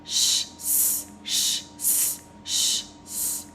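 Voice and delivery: voiceless "sh" then "s", alternated; hissed sh and s. Shown as a demonstration. Teacher contrasts the sh and s sounds. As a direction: hiss sh then s back and forth. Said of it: "hear the difference between the sh and s".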